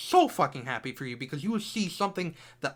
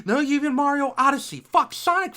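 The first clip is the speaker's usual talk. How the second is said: outburst; 7 semitones higher, 7 dB louder than usual. Real, not acted. Ranting, comparing games.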